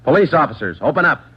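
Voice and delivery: bang out the command to open up; shouted command. Acted. Police calling at motel door.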